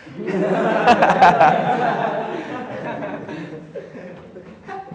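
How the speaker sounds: a long, high burst of laughter; real laugh. Real, not acted. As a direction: laugh out freely at yourself. Laughs at own remark. (risa real y larga)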